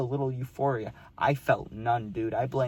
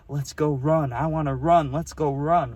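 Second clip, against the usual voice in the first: louder, mock-excited movie quote; imitated hyped-up urging; 2 semitones higher, 6 dB louder than usual. Imitating a crack-smoking movie character itching to run, mock excited. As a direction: quote it with pushy, excited force.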